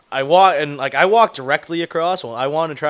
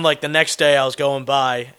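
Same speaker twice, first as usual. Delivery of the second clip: ordinary talk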